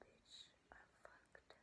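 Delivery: slow, very soft whisper; panicked whisper. Retold or imitated. Teller hearing sirens outside the house, whispering. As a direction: whisper it as if the police might hear.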